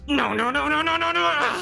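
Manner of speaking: high, frantic string of refusals; agitated cleric. Acted. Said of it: "watch as he calms down".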